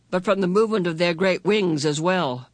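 ordinary delivery, nothing marked

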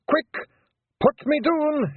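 high, loud yell from a struggling pixie; urgent Scottish yell. A narrator's reading. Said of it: "he yelled".

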